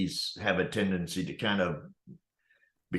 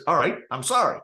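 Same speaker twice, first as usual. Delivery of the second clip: higher, reluctant give-in; grudging apology; 8 semitones higher, 4 dB louder than usual. Retold or imitated. Manager finally caving to the customer, grudging. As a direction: give in just to end it. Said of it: "the manager looks at him and says".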